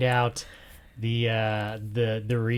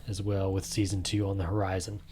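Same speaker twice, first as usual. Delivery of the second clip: whispered tease; mock-secret whisper; 4 semitones lower, 8 dB quieter, faster than usual. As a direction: hush it like a secret. Teasing upcoming season.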